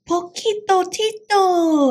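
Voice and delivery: high, loud, drawn-out cry of recognition; exclaiming. A narrator's reading. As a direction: call out the friend's name in delight. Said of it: "exclaimed Skipito".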